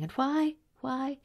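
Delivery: high-pitched rhetorical questioning; rhetorical challenge. Acted. Woman mocking atheists' deathbed fears, high challenging questions.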